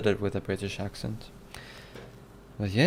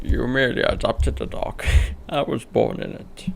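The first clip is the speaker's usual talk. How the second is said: Bane voice; 3 dB louder than usual. Acted. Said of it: "Bane quote".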